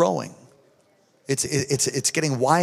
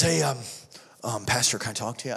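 hushed retold ask; 4 semitones lower, 8 dB quieter than usual. Grieving father quotes friend.

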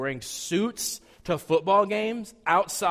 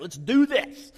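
pulpit-pounding hype, louder; mock pumped up; 4 semitones higher, 5 dB louder, slower than usual. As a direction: ham up the fire-and-brimstone eagerness. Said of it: "I get to pound the pulpit".

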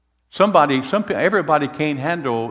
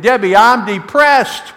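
loud, slow, higher cry of a confession; yelled confession; 8 semitones higher, 7 dB louder, slower than usual. Retold or imitated. Husband yelling out to his wife Debbie, loud and slow. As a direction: call it out across the house, drawn out. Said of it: "And I yelled out to Debbie".

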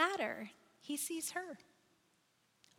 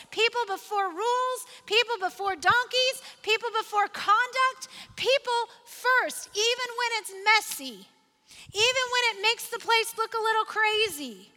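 louder, higher, driving sermon delivery; full-force preaching; 5 semitones higher, 5 dB louder than usual. Acted. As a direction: preach it out to the whole room. Pastor builds to full-force preaching.

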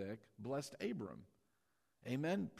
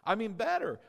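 "better" punched louder and higher; emphatic better; 8 semitones higher, 6 dB louder than usual. Shown as a demonstration. Preacher rousing the congregation to repeat "better", loud and emphatic. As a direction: hit "better" hard so they echo it. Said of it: "Say it like you mean it this time".

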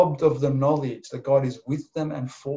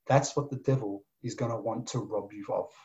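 normal talking voice

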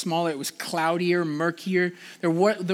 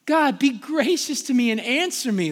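raised, earnest plea to God; pleading cry; 6 semitones higher than usual. Retold or imitated. Preacher voicing David pleading with God, raised and earnest. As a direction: voice David crying out for help. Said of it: "pleading with God".

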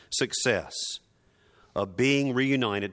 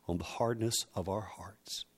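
whisper; 3 semitones lower, 9 dB quieter than usual. Real, not acted. Whispered within the prayer.

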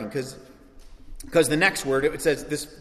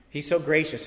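normal talking voice

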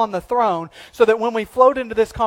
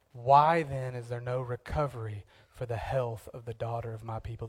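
low, soft grieving plea; a hushed lament for the people; soft mournful lament; 7 semitones lower, 11 dB quieter than usual. Retold or imitated. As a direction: plead quietly as the weeping prophet. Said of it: "This is what he cries out".

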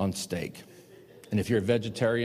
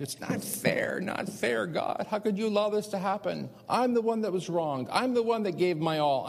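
put-on whining child; 10 semitones higher than usual. Preacher re-enacts his tearful complaint to God, whining like a child. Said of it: "how you are when you're a child".

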